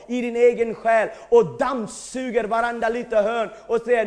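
ordinary talk

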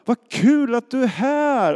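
high, loud, drawn-out cheer of welcome; delighted greeting. Retold or imitated. Colleagues greeting the pastor they feared were angry, high excited welcome. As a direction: gush it like an overjoyed colleague.

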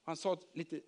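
ordinary delivery, nothing marked

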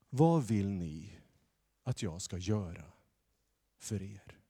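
slow, soft asking question; gentle Jesus. Acted. Jesus gently asking the blind beggars, slow and soft. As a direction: ask the beggars kindly, taking time.

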